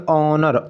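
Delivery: ऑनर read out in two parts, ऑ-नर; sounded out. Shown as a demonstration. Teacher reads the word to test syllables, sounded out. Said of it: "जरा एक बार पढ़ के देखते हैं".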